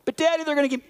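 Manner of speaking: put-on high, pleading little kid's voice; scared little boy. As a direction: whine like a frightened son. Son panicking about a shot.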